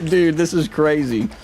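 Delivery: ordinary talk, nothing marked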